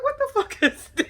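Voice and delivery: high-pitched, laughing disbelief; incredulous outburst. Retold or imitated. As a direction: squeal it in mock shock. Reacting to the fight, incredulous.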